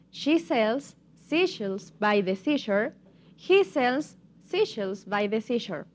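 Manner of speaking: slow, measured tongue twister; slow demonstration. Shown as a demonstration. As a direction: take each phrase slowly for learners. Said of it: "but now a little bit faster".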